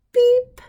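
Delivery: a squeaky toy-truck beep, way up high; high horn beep. Acted. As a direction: beep like a tiny friendly horn. Truck's horn in a picture book.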